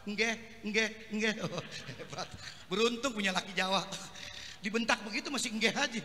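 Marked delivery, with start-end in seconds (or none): 0.1-1.3 soft, lower meek yes; patient husband answering his scolding wife; meek Javanese husband. Acted. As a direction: give in humbly. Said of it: "Jawa sabar banget".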